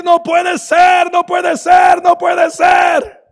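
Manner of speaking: high, loud, slow cries of disbelief; hammed-up anguished yelling; despairing shout. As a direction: wail it out like a man at the end of his rope. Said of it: "Y empezó a decir".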